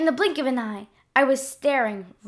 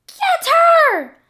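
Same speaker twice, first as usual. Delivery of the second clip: very high, loud screeching monster; monster scream; 9 dB louder than usual. Acted. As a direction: scream the order like a furious blob. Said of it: "the monster screamed".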